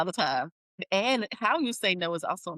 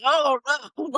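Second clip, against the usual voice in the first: shocked oh no; 7 semitones higher, 4 dB louder than usual. Retold or imitated. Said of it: "I was like".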